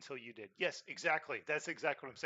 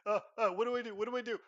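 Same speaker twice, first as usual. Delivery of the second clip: high flustered mock panic; panicked; 8 semitones higher, 8 dB louder, faster than usual. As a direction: act lost and scrambling. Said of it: "they're like".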